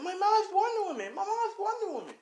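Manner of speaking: put-on very high-pitched squealing kid voice; excited child yelling. A narrator's reading. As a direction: squeal it out like a thrilled, boasting little girl. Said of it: "As Melissa starts yelling".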